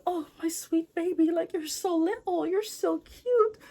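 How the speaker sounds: high, babying mock-mom voice; cooing mother. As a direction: coo over a tiny child, drawing out the end. Acted mother coos at little son.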